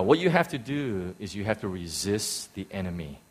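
ordinary delivery

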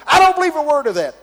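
loud high heckler shout. Retold or imitated. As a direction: shout him down. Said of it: "heckler said again".

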